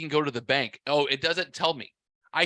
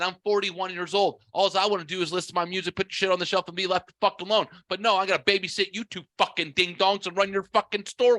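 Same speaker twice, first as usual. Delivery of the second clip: higher, fast exasperated tirade; retold angry rant; 4 semitones higher than usual. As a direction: rattle off the complaints, fed up. Re-enacts chewing out the manager.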